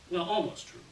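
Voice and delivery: ordinary delivery, nothing marked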